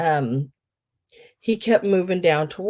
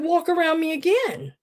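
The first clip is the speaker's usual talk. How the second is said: sarcastic retort; 10 semitones higher than usual. Retold or imitated. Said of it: "I looked at him and said".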